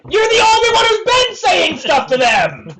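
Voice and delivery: high, loud accusing shout. Real, not acted. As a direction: yell the accusation.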